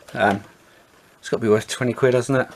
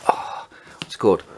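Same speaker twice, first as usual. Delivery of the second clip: whispering to self. Real, not acted. Trying to recall a game name.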